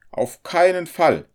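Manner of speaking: high, loud indignant refusal; irritated landlady putting her foot down; annoyed. A narrator's reading. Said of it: "entgegnete sie ärgerlich".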